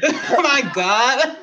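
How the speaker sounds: laughing hard, high and slow; breaking up with laughter. Real, not acted. Cracking up after the freestyle.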